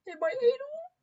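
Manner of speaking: high, incredulous squeak of a question; disbelieving high-pitched echo; shocked disbelief. Retold or imitated. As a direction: sound scandalised, pitch leaps up on anal. Shocked reply to his message.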